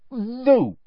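prim, mouth-full "no"; strained dignity. A narrator's reading. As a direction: deny it with all the dignity you can muster. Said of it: "with as much dignity as she could muster".

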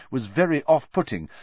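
ordinary delivery, nothing marked

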